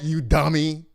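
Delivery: usual speaking voice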